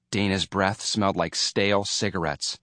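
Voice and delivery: usual speaking voice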